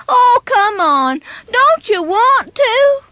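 lightly voiced kid egging a friend on; coaxing. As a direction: dare him gently to join in. Friend keeps coaxing Casey.

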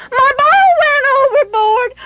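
put-on high wailing child's voice, rising at the end; a small boy wailing about his lost ball, pitch lifting; crying little boy. A narrator's reading. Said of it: "Benny cried".

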